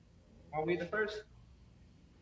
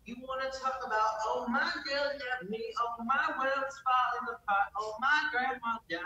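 higher mock hillbilly twang on the lament; 6 semitones higher than usual. Goofing with a friend, hammed-up hillbilly twang. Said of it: "Suck it, hillbilly ass voice".

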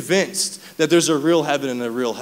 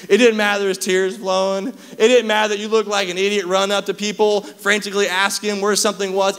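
building sermon; 6 semitones higher than usual. Acted. Pastor urging the congregation, building preaching fervor. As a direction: drive the repeated "it didn't matter" home.

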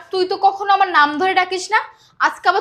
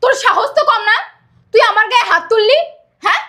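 loud, higher, outraged shouting; angry retort; 4 semitones higher, 3 dB louder than usual. Acted. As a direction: fling it back at her furiously. Maid fights back after a slap. (চড় খেয়ে রাগে চিৎকার করে পাল্টা জবাব)

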